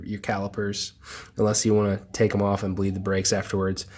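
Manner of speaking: ordinary delivery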